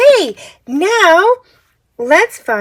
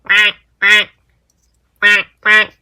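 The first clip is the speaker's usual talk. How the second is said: low quacking duck sound; 6 semitones lower than usual. Shown as a demonstration. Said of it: "Duck says".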